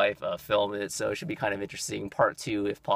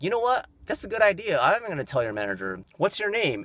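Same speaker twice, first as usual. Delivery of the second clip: indignant, challenging retort, much higher voice; mock-eager confrontation with a raised pitch; retold defiant comeback; 8 semitones higher than usual. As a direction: act out calling someone's bluff, pitched high. Acts out confronting the rude clerk.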